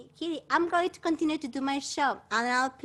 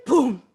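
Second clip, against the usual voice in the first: a louder boom as the leaf lands; 11 dB louder than usual. Shown as a demonstration. Showing the class the leaf's loud boom. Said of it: "Put your finger up and you go".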